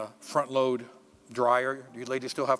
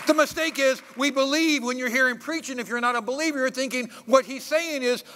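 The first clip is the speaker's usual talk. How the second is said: loud, raised preacher's delivery; preaching; 8 semitones higher, 5 dB louder than usual. Real, not acted. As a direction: drive the point home to the congregation. Preaches at full force.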